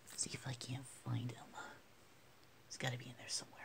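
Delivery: soft whisper to avoid spooking him. Real, not acted. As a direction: keep it a hushed whisper. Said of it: "sorry for whispering".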